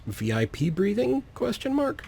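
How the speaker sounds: high-pitched, curious question with a rising end; eager student question. Retold or imitated. Player mocks the game's upgrade text, high curious student voice. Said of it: "Raising his hand says".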